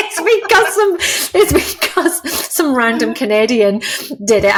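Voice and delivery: words squeezed out through a high giggle; laughing. Real, not acted. Author laughing about who voiced her book. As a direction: laugh the line out.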